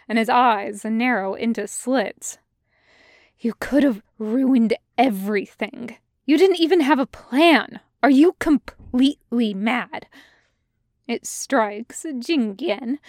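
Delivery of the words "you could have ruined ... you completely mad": sharp, loud accusing voice; angry. Acted. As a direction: scold him in raised fury. Xiao Shu angrily confronts Jing Yan.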